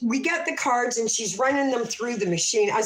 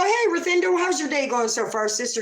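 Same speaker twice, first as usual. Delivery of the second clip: chirpy mock greeting; 6 semitones higher than usual. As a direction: greet her with over-familiar pep. Acts out greeting the clerk.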